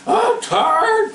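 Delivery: very high, whiny fuss; tired young mule fussing at the plow. A narrator's reading. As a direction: complain like a worn-out young mule. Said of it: "fussed Favor".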